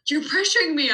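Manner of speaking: high, loud mock protest; imitated child complaint. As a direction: mimic a flustered small child pushing back. Imitates upset child complaining to parent.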